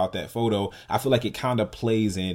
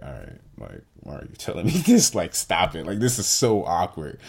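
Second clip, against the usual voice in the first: put-on awkward teen son; 4 semitones lower than usual. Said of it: "And I used to be like".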